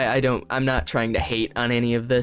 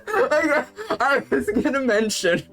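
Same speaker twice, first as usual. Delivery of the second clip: higher, giggling aside; laughing through words; 8 semitones higher than usual. Real, not acted. Breaking from the fic to mock it, laughing. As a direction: let the laugh spill into the words.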